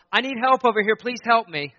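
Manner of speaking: calling for help. Acted. Preacher mimics people asking for help, loud acted plea. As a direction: call out urgently for someone to help.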